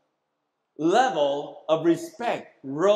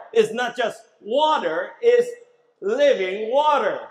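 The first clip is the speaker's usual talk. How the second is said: "living water" punched louder; emphatic preaching; 4 semitones higher, 6 dB louder than usual. Real, not acted. Preacher urging his congregation, "living water" hit loud. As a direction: hammer home "living water" to the room.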